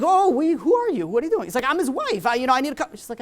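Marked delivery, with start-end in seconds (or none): 0.0-1.5 high, loud retold alarm; startled challenge. Nurse challenges woman barging into the room, acted alarm. 1.7-2.9 urgent insistence. Retold or imitated. Wife insists on getting in.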